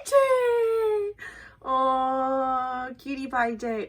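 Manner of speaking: high, loud delighted cry of a name; excited squeal. Real, not acted. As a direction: squeal his name like a fan. Sees favourite member's card.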